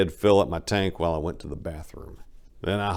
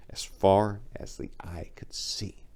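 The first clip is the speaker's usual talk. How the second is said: hushed awe; 5 dB quieter, slower than usual. Real, not acted. Awed by the view.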